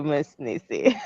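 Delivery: ordinary talk, nothing marked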